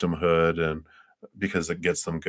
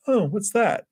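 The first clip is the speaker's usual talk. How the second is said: mock surprise; 13 semitones higher than usual. Acting out the crier noticing a text.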